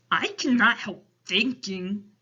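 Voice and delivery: loud storybook giant voice; concerned, rustic-sounding giant; Big Friendly Giant. A narrator's reading. Giant speaking to Sophie.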